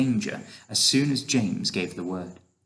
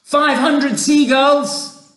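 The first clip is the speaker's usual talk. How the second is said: slow, high, loud yell; loud, stretched-out announcement; shouting; 8 dB louder, much slower than usual. A narrator's reading. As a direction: yell out the tally triumphantly. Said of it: "James shouted".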